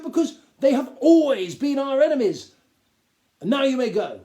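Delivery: high, loud, self-important Duke lecturing; a pompous gnome lord talking down to the boy. Acted. Duke's pompous speech.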